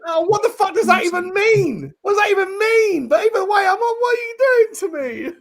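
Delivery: high, loud mock-distressed wail; hammed-up despairing cry; mock panic. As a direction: wail it like the aura news broke you. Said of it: "And I was like".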